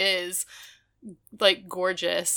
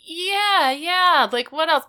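eager yes; 8 semitones higher, 6 dB louder than usual. Retold or imitated. Lonnie eagerly agreeing, voiced.

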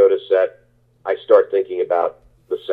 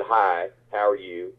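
soft, slow in-character greeting; 7 dB quieter, slower than usual. Retold or imitated. Actor greets a castmate while living in his character's accent, soft imitation. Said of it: "live in his accent".

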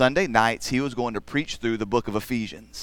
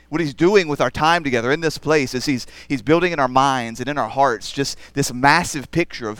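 louder, driving sermon delivery; preaching; 2 semitones higher, 4 dB louder than usual. Acted. Pastor preaching to the congregation, growing louder and fervent. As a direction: build the point with rising force.

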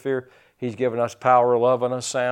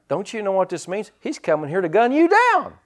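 lightly voiced panicky deputy, rising high; a hint of a jittery Barney; Barney's alarm; 7 semitones higher, 4 dB louder than usual. Deputy warns the sheriff, mildly mock-panicky. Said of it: "And so Barney's like".

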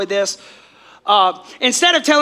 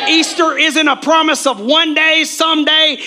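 loud, high, fervent preaching; 6 semitones higher, 3 dB louder than usual. Acted. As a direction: build it like a sermon climax. Preaching climax at full force.

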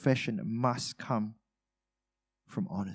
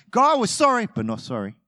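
sorry not sorry; 8 semitones higher, 5 dB louder than usual. Retold or imitated. Believers praying to God, sorry not sorry. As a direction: fake remorse, then drop it. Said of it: "that's what we're saying".

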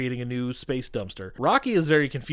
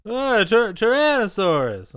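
awkward Rocky; 9 semitones higher, 6 dB louder, slower than usual. Retold or imitated. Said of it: "very on brand".

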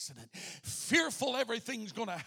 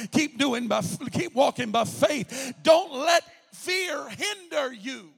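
loud, ringing preacher's cry over the music; full-force preaching; 8 dB louder than usual. Acted. Preacher urging the church on, loud and ringing. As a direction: build the sermon to a shout.

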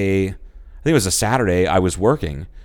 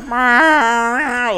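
high, slow silly meowing; high mock kitty voice; cartoon cat; 4 dB louder than usual. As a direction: play a goofy big cat. Stoned jaguar meowing.

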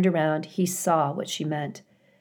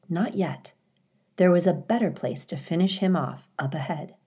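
usual speaking voice

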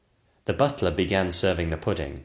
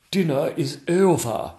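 ordinary delivery, nothing marked

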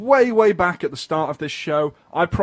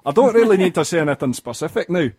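show-off Scottish accent. Shown as a demonstration. Proving to a co-host he can do it, Scottish accent. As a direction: slip proudly into a Scottish accent. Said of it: "you can do a Scottish accent".

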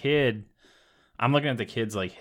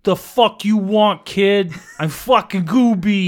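tough Gooby; 7 semitones higher, 8 dB louder than usual. Pretend. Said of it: "It's like".

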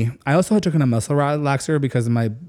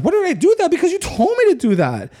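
much higher, whiny and defensive; indignant mock retort; faster than usual. Answering David's why, much higher and defensive. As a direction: throw it back at him, high and defensive. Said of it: "He's like".

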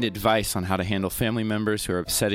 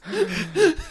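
laughing; 9 semitones higher, 7 dB louder than usual. Real, not acted. Laughing at the Salty tangent.

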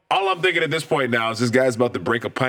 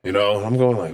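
ordinary talk, nothing marked

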